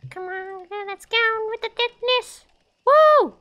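high playful squeal ending in a whoop; playful cheer. Real, not acted. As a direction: cheer in a high voice, building to a loud woo.

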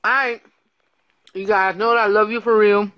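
muffled through fingers; hand over mouth. Real, not acted. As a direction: fingers against lips. Seen 0: Hand or fingers over lips for most words; free 7-10s.

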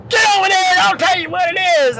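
high, strained mock yell; mock instructor shout. Instructor ordering trainee to the wall, mock shout. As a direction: imitate a drill instructor ordering someone.

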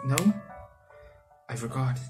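ordinary talk

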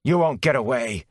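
spat out angrily; angry. Acted. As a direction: spit the threat out. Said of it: "he spat angrily".

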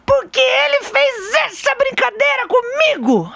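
put-on high, slow stiff kid's shout; angry child. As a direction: complain like a rigid, cross little boy. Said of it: "Brabo, todo rígido assim". (criança brava e rígida)

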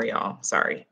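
ordinary talk, nothing marked

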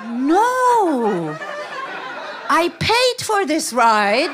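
loud, long drawn-out protest; mock indignation. Passenger takes driver's exclamation as an order to leave, mock indignation. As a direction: refuse loudly, stretching the words as if affronted.

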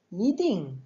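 "yiding" rises on yi, falls on ding; deuxième puis quatrième ton. Shown as a demonstration. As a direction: arch "yiding" up, then drop it. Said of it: "I deuxième ton, comme dans". (« yiding » : yi monte, ding retombe)

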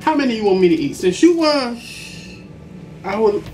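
usual speaking voice

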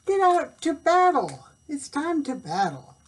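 high, loud battle cry; rally the troops; bold knight. A narrator's reading. Knight's battle call in story.

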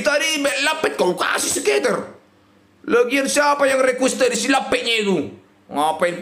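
ordinary delivery

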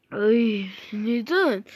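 ordinary talk, nothing marked

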